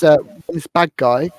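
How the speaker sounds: ordinary delivery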